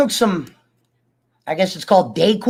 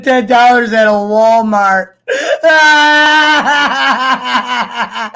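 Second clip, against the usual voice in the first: drawn out through real laughter; laughing; 3 semitones higher, 3 dB louder, much slower than usual. Real, not acted. Repeating Frank's Walmart price to viewers, laughing. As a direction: crack up while slowly repeating it.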